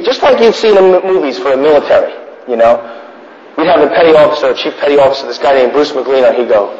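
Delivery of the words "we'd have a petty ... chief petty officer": ordinary talk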